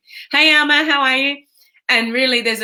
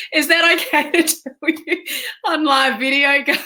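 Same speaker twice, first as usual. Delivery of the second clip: asked through real laughter, pitched higher; laughing; 6 semitones higher than usual. Real, not acted. Asking her live viewers while laughing. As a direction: giggle your way through the question.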